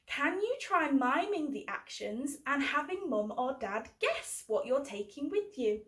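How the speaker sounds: plain reading voice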